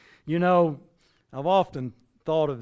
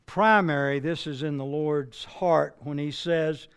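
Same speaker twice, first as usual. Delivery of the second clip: normal talking voice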